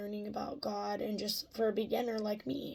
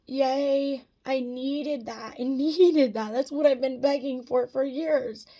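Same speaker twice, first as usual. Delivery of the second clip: excited; 4 semitones higher, 10 dB louder, slower than usual. Real, not acted. Overjoyed at the pastor's offer.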